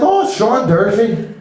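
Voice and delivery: slow Irish caller voice; Irish accent. Acted. Caller giving his name to the limo clerk, Irish accent. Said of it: "he has this Irish accent".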